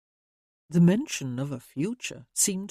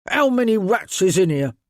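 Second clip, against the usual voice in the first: gruff rough-voiced ratcatcher, scowling; scowling Phelps; 3 semitones higher, 5 dB louder than usual. A narrator's reading. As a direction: growl it out like a grumpy workman.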